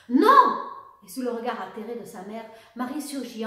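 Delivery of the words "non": sudden high, very loud cry; icy cry. A narrator's reading. Daughter interrupts her mother from the doorway, crying out. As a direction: cry out to stop them dead. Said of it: "un cri glacé l'interrompit tout à coup".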